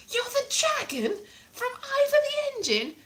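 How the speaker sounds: very high, put-on questioning voice; excited questioner. Someone mistaking her for a TV dragon, high put-on voice. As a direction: ask in high, comic amazement.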